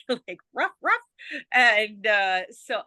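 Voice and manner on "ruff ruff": playful high "ruff, ruff" barks; dog bark imitation. Joking that rough sounds like a dog, mock barking. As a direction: yap it like a small dog. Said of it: "Dog, bark, bark".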